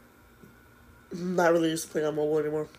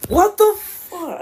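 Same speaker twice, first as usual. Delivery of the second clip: stunned outburst; 10 semitones higher, 6 dB louder, much slower than usual. Real, not acted. Frustrated gamer sees bad play.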